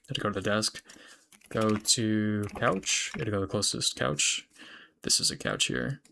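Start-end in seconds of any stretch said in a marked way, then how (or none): 1.5-3.1 drawn out, word by word; slow dictation. Real, not acted. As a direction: spell it out slowly as you type. Dictating while typing command.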